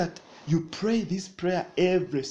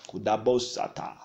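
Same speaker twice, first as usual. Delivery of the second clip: normal talking voice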